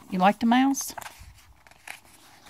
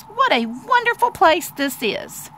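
high, wondering little mouse; high-pitched storybook wonder; murmuring Little Mouse; 8 semitones higher than usual. Acted. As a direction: marvel at it as a tiny mouse. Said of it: "murmured Little Mouse".

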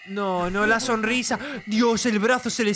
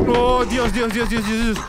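real fright; 4 semitones higher, 5 dB louder than usual. Real, not acted. Jumpscare in horror game.

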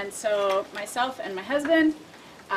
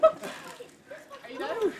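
laughing; 13 semitones higher, 4 dB quieter, much slower than usual. Real, not acted. Laughing after icy water.

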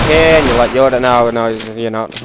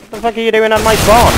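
angry; 8 semitones higher, faster than usual. Real, not acted. Angry at enemy camping spawn.

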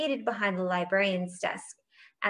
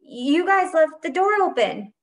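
high, loud defensive protest; flustered, raised voice; sputtered excuse; 7 semitones higher, 6 dB louder than usual. A narrator's reading. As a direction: blurt out an excuse, caught out. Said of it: "he sputtered".

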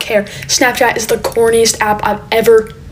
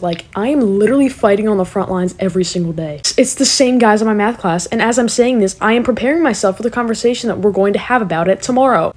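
ordinary talk, nothing marked

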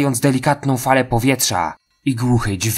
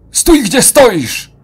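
far higher, loud, slow yell; shout; 12 semitones higher, 6 dB louder, much slower than usual. Acted. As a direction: scream a warning at the killer. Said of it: "krzyknął agent". (krzyk, głośno i wysoko)

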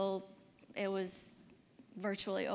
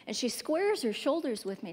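ordinary talk, nothing marked

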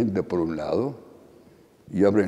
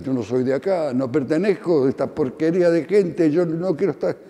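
mock yell climbing higher and higher; retold outcry, higher-pitched; 7 semitones higher, 3 dB louder than usual. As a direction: imitate a frantic protest, pitch rising, not full volume. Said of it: "Yo empecé a gritar".

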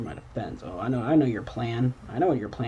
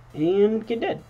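gleeful taunt; 10 semitones higher, 5 dB louder, slower than usual. Real, not acted. Taunting the fainting enemy.

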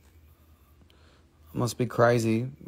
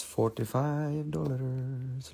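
quiet drawn-out price; 10 dB quieter, much slower than usual. Acted. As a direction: let the price fade away. Repeating price in varied tones.

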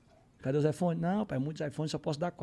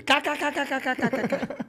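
mocking giggle; 8 semitones higher than usual. Neymar laughing at the prank.

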